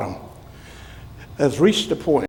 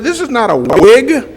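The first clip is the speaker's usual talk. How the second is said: laughing; 10 semitones higher, 7 dB louder than usual. Retold or imitated. His own retold indignant reply.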